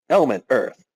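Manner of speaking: usual speaking voice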